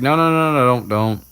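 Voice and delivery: hammed-up alarm to stop him; panicked protest. Ron panics about duel.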